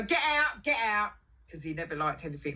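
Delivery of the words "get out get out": high, loud flustered order; mock angry yell; boss throwing her out. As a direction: imitate a boss ordering someone out.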